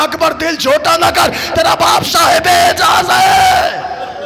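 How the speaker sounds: high, loud grieving chant of the tale; wailing lament recitation. Acted. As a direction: cry the scene out to the mourners. Wailed consolation. (ऊँची आवाज़ में मातमी मरसिया ख़्वानी)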